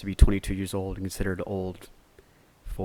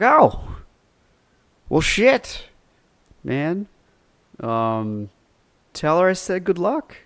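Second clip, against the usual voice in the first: higher and louder, a lightly acted awestruck gush; starstruck reply; 10 semitones higher, 7 dB louder, slower than usual. Clerk telling the gymnast's brother to pass on good luck, mild starstruck gush. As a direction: gush a little, caught off guard. Retold awed reply to customer.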